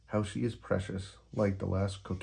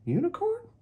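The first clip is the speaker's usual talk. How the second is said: very high worried kitten; Kitty checking on her friend; Kitty asking; 3 dB louder than usual. Acted. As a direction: call to him gently. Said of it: "asks Kitty".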